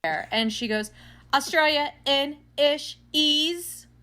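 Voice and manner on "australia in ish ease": louder, higher, drawn-out syllable by syllable; imitated slow answer. Cashier naming what Australians speak, slow and loud. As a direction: imitate someone spelling out a made-up word slowly.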